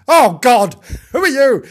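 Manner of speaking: high, loud horrified outburst; appalled shock. Acted. Finding someone in the kitchen, appalled and loud. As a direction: recoil loudly at a stranger.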